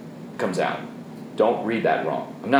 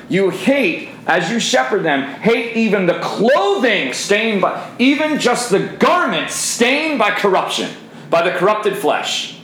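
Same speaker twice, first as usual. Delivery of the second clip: much higher, slow, ringing sermon voice; preaching at full force; 7 semitones higher, slower than usual. Acted. Preacher pushes his men hard against sin, high and slow.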